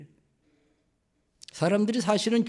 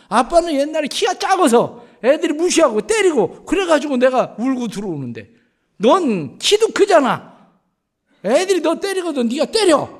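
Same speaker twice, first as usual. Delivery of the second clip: scolding father; 8 semitones higher, 6 dB louder than usual. Retold or imitated. Father scolding crying son.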